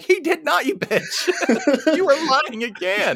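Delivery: hammed-up outrage at the liar, pitched far up; mock outrage. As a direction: shriek it up high at the lying girl like you're fed up. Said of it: "I'm like".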